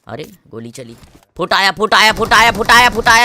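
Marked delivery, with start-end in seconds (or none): 1.4-3.3 loud, rising gleeful yell; hyped-up gamer yell; excited shout. Real, not acted. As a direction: shout it out as the shots hit. Gunfire erupts, excited shouting.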